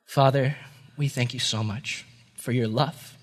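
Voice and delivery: low, soft, unhurried praying; quiet closing prayer; heartfelt prayer. Real, not acted. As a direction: pray gently, pausing between phrases.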